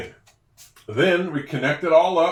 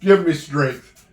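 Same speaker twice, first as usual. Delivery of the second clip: mock exasperation; 6 dB louder, slower than usual. Real, not acted. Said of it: "exasperated after laughing".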